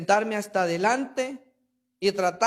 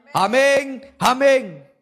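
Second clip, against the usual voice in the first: a loud, ringing double amen from the crowd; the people answering Ezra together; 6 dB louder, much slower than usual. Acted. Preacher voicing the people's ringing amen. Congregation's response acted out.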